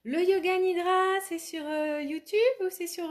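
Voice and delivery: playful imitation of puzzled messages; imitating questioners. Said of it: "des messages pour me demander".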